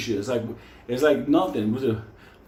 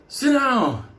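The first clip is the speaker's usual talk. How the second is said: mock cop order; 8 semitones higher, 3 dB louder than usual.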